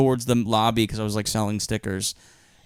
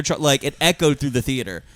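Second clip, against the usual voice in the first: ordinary talk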